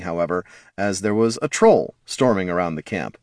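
usual speaking voice

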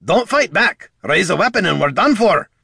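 high, loud dwarf warning; urgent shout. A narrator's reading. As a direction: shout a warning over the mob. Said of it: "the shouts of a familiar voice rang out".

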